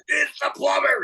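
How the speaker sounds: hammed-up, very high exasperated cry; very agitated plumber. Plumber at his last straw with the parrot, agitated high cry. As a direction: act a plumber at the end of his rope. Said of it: "Guy's getting increasingly agitated".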